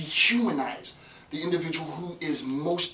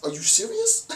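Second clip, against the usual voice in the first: much higher, squeaky incredulous "serious"; mock disbelief. Lecturer scoffing at waiting for handouts, in high mock disbelief. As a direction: squeal it in fake shock. Mocking the begging idea.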